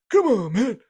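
loud, high-pitched exasperated politician voice; Joe Biden impression. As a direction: burst out in a hammed-up presidential impression. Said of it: "Joe Biden voice".

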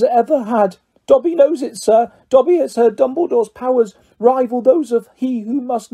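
high-pitched elf voice, insistent; Dobby, earnest house-elf. Acted. House-elf praises the headmaster to Harry, high and earnest. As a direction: speak high, an elf full of reverence.

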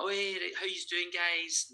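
put-on breezy greeting, pitched high; fake cheerfulness. Greeting the gym crowd while hiding the news, fake cheerful. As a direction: mimic a falsely upbeat hello, high and light. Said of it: "I'm just putting on this fake".

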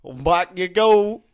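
high, loud hillbilly voice, slowing at the end; cartoon yokel calling out; Cletus. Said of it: "He's like".

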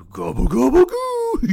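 very high, loud cartoon dog gobble; Scooby-Doo voice. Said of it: "I'm gonna try my Scooby-Doo gobble gobble".

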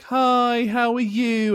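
high, sing-song mock cheerfulness; imitating a manager's overly sweet hello; fake friendly boss. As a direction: greet like a falsely cheery manager. Boss's fake friendly greeting.

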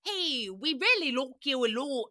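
badly faked Irish accent. Shown as a demonstration. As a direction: have a shaky go at an Irish accent. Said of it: "can I do an Irish accent".

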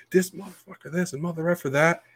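high-pitched mock tirade; mock ranting. Student complaining to the superintendent, mock high-pitched rant. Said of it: "And he's running mouth".

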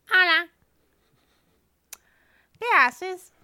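put-on high, loud little kid voice; child voice. Kid asks injured man what he's doing, high child voice. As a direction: play a small boy greeting a stranger.

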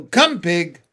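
loud farmer's summons; calling an animal. A narrator's reading. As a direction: call the pig over. Farmer calls pig to pail.

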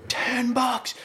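high-pitched, soft imitation of outrage; mock going off. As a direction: mimic an indignant outburst in a squeaky voice. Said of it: "he just started going off".

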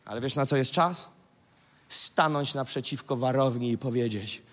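usual speaking voice

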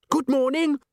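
very high, bubbly sing-out; chirpy greeting. A narrator's reading. Christine chirps to a sleepy friend at breakfast, gushing. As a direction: trill it with overdone brightness.

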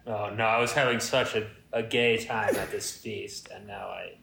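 usual speaking voice

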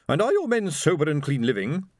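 high-pitched, stern moral inquiry; demanding matron. A narrator's reading. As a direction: interrogate him like a disapproving lady. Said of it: "the woman demanded".

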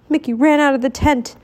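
ordinary delivery, nothing marked

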